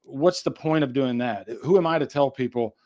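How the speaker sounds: ordinary talk, nothing marked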